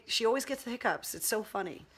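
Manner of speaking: ordinary talk, nothing marked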